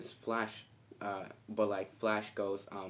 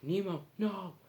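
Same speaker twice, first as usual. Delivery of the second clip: high, sudden cry of Nemo, no; alarmed dad cry; 10 semitones higher than usual. Retold or imitated. Marlin grabs Nemo from the open water, high alarmed cry.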